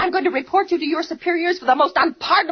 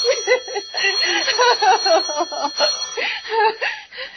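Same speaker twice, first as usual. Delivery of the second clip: hysterical sob-laugh; 5 semitones higher than usual. Acted. Hysterical breakdown.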